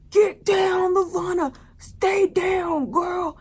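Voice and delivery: high, loud warning cry; urgent shout. Retold or imitated. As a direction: yell at her to duck. Everett warning Levana before shot.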